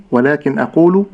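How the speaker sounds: ordinary delivery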